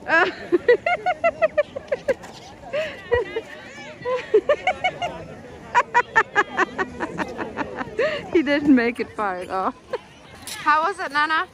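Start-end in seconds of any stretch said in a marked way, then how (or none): 0.3-8.3 high, drawn-out laughter; long laugh. Real, not acted. Laughing at Dante's short run.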